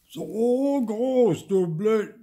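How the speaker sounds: slow, very loud, high roar; bellowed. A narrator's reading. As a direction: roar it out, losing his temper. Gauber still bellowing.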